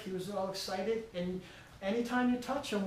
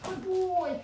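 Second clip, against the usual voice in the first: much higher happy praise to the dog; 11 semitones higher than usual. Real, not acted. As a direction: lift the voice high to reward him. Trainer rewarding dog after release.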